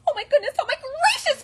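very high, loud strained chick squeal; Chicken Little. A narrator's reading. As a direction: cry it in exhausted panic. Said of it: "croaked Chicken Little".

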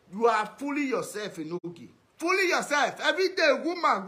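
normal talking voice

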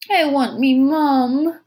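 high, loud, drawn-out little wail; child's wail. A narrator's reading. Tiny tyke wants her mother, high slow wail. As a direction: wail slowly like a frightened toddler. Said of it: "the tyke wailed".

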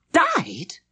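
high, loud shocked question; shrieked, startled one-word question; almost a shriek. A narrator's reading. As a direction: cry it out in disbelief, pitch shooting up. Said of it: "I almost shrieked".

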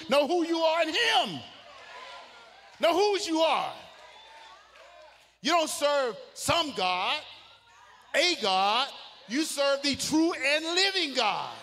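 full-force preaching. Acted. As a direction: preach it out to the rafters.

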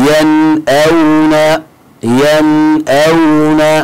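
"Yan'awna" very slow, each sound drawn out, clear n before the hamza; clear idhaar pronunciation. Shown as a demonstration. Tajweed teacher shows students how to pronounce it, "Yan'awna" slow and clear. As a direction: recite "Yan'awna" very slowly, long and level, with the n sounded clearly. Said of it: "saas ugu dhawaaqaysa".

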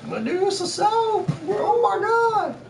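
high, slow shocked squeal; mock shock.

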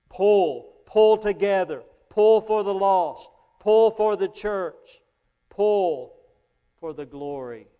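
loud, high rallying preaching; rally the congregation, drawn out; fiery revival call. Acted. Preaching climax.

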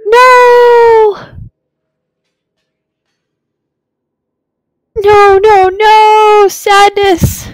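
shrill, hammed-up cries of despair; high anguished shout. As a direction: wail no like your heart breaks. Reacting to a sad game scene.

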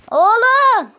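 high, loud outburst of disbelief; exasperated gamer's shout; frustrated yell. Real, not acted. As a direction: yell it out, pitch shooting up. Frustrated cry at bad box result.

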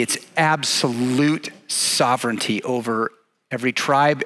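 ordinary talk, nothing marked

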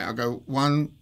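ordinary talk

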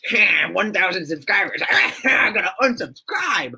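mock a troll, pushing the pitch up at the end. Imitating the funny troll person who unsubscribes, mocking voice. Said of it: "the funny troll person".

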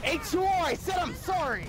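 high, shouted apology; angry apology. Real, not acted. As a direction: snap the sorry out, fed up.

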